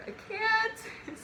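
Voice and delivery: high, small squeal of helplessness; high-pitched retold protest; helpless mock plea. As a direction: squeak it softly, about to crack up. Retelling her struggle not to laugh.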